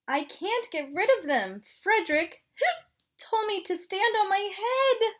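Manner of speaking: hiccupy little penguin, gasping; chatty penguin with hiccups; penguin character voice. Acted.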